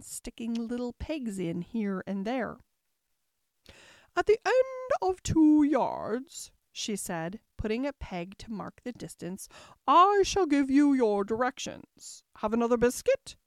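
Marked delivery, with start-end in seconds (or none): 4.2-6.5 high, loud, slow pronouncement; pompous queen. A narrator's reading. Queen pompously gives instructions. 9.9-13.3 loud queenly pronouncement; pompous queen. A narrator's reading. Queen pompously gives instructions.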